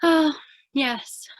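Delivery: ordinary delivery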